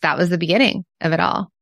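ordinary talk

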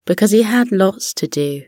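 usual speaking voice

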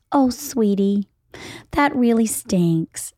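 lightly voiced syrupy parent, slow; a hint of a saccharine mom, drawn out; mock sweet tone. Mom mocking a sweet reply to her kid, mild syrup. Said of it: "Should I be like this?".

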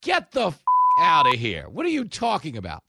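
loud, high-pitched acted disbelief; exasperated mock shout; incredulous outburst. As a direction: throw it back at them, loud and incredulous. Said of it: "And we were like".

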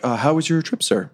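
lightly voiced steward; polite steward. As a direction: enquire politely as a servant. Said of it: "the steward is like".

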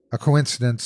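ordinary delivery, nothing marked

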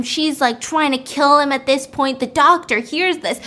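usual speaking voice

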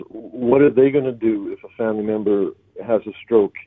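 ordinary talk, nothing marked

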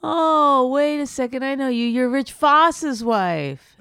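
loud, high exclamation of sudden recognition; mock comic's greeting. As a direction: imitate him loudly, high-pitched, stretching out the end. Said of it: "This is what he said to me when he met me".

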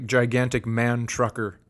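ordinary delivery

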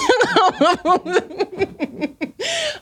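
real laugh, high and bright; a hearty laugh at her own joke; high burst of laughter. Real, not acted. Big laugh after joke.

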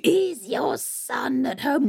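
slow sly hag; troll hag. Acted. Troll hag at the door, slow and sly. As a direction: ask slyly.

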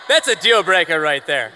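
a loud, emphatic deal breaker; a real shout, landing the verdict hard. Real, not acted. Panelist shouting the verdict to the host and crowd.

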